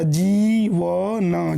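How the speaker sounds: जीवन slowly built up, ī held; sounded out in parts. Shown as a demonstration. Teacher showing how to read it, जीवन drawn out in pieces. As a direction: sound out जीवन part by part with a long ī. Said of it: "आप इसको कैसे पढ़ेंगे".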